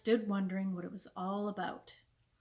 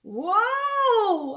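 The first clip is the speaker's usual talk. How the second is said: long, high, loud whoa; calling the calves to a halt; shouted command; 11 semitones higher, 9 dB louder than usual. A narrator's reading. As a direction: holler it high and draw the vowel out. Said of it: "Almanzo shouted when they reached him".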